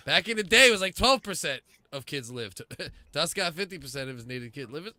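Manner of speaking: ordinary talk, nothing marked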